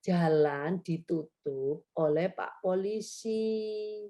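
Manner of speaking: slow and clear, every word spelled out; gentle slow full-sentence correction; slow corrective modelling. Shown as a demonstration. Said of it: "Ibu langsung membenarkan kembali".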